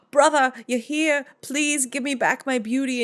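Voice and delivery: desperate sister begging in a high voice; high pleading queen. Acted. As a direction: plead for your beauty back in a high voice. Queen pleads with her brother.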